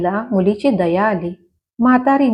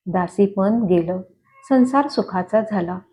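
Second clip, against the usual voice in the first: ordinary delivery, nothing marked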